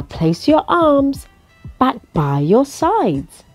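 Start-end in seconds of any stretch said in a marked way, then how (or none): none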